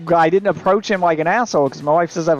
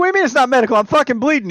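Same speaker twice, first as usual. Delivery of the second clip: higher-pitched retold outrage; indignant protest; 6 semitones higher, faster than usual. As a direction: argue back in exasperated disbelief. Retold quarrel over what is medical.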